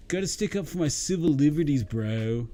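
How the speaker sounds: lightly mocking a smug protester. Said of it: "They're like".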